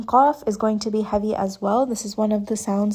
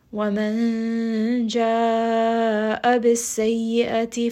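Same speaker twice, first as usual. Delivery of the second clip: Quran recitation. Shown as a demonstration. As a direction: recite it melodically, drawing out the vowels.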